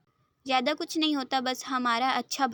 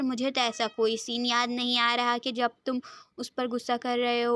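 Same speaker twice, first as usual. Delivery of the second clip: usual speaking voice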